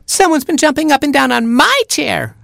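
put-on very high squealing baby chick; screaming chick. Baby chick finding his chair gone, screaming high. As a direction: shriek like a tiny chick. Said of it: "screamed Baby Chick".

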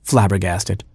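ordinary delivery

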